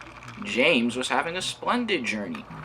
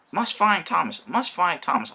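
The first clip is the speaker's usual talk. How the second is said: urgent; 8 semitones higher, 4 dB louder than usual. Acted. James hurrying worried.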